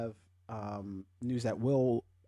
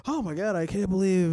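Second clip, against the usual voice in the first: mocking outraged fans; 9 semitones higher, 5 dB louder than usual.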